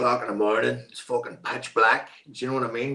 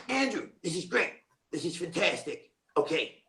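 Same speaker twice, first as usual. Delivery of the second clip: softer, higher voice of an approving director; imitated director; 5 semitones higher, 6 dB quieter than usual. Director praising a flustered actor, softly approving. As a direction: imitate a director giving quick, soft praise. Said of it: "And he just went".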